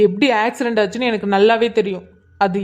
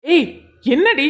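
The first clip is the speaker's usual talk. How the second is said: angry challenge; 3 semitones higher, slower than usual. Acted. Husband challenges wife accusing his sister, angry. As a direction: cut in angrily, pitch jumping up.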